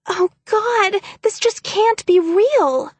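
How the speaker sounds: high, loud, slow panicked wail; feigned terror. Acted. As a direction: sell a fake panic, voice cracking high.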